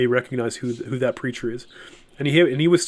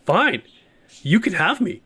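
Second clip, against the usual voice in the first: bitter, higher, sharp retort; angry and bitter; 6 semitones higher than usual. Retold or imitated. Talking back to God's voice on the roadside, a bitter angry giving in. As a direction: throw it back in bitter surrender. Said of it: "in an angry, bitter way, I said it".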